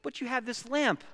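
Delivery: high-pitched bewildered Big Bear; exasperated protest. Acted. Big Bear reasoning with Little Bear about the lamp, exasperated. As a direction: protest like a baffled parent in a story.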